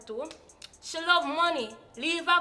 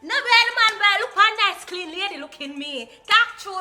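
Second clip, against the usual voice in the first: excited; 6 semitones higher, 8 dB louder than usual. Real, not acted. Hyped boasting.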